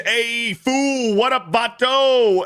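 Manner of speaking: high, loud street greeting read aloud; high, excited hey fool read-out; reading chat in hype voice. Retold or imitated. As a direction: pitch it up and shout the greeting.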